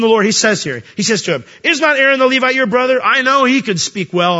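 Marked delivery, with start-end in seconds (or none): 1.6-3.0 higher, louder sarcastic retort; God mocking Moses, sarcastic and louder. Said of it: "a bit of sarcasm in that tone". 3.0-4.4 dry sarcastic question, a touch louder; God teasing Moses with light sarcasm. Retold or imitated. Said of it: "a bit of sarcasm in that tone".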